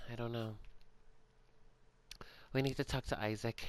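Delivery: normal talking voice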